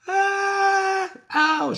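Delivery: high pained witch cries; shrill burnt witch yowls; witch's cries of pain. Acted. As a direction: yelp as the flames catch you. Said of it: "with terrible cries".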